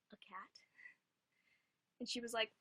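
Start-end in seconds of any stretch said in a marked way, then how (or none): 0.1-0.6 breathy whispered "A cat?"; whispered surprise. Retold or imitated. Mom hears friend's advice to get a cat, whispered surprise. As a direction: whisper it in quiet disbelief. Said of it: "And my mom was like".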